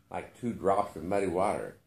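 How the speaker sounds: plain reading voice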